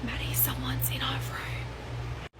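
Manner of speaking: slow, voiceless whisper; urgent whisper. Retold or imitated. Mum waking daughter about an intruder, whispering. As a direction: whisper it as a secret warning. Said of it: "like, whispering something to me".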